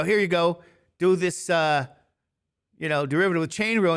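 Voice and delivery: playful imitation of a demanding teacher; mock teacher yell. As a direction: hand out the pop quiz like a stern teacher barking. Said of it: "he'd yell at you".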